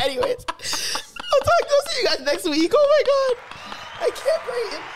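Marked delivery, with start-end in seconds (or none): none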